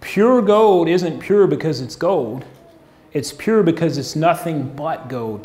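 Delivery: slow and deliberate; measured sermon emphasis; slowly. Shown as a demonstration. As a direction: let every word land slowly. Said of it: "let me say this slowly".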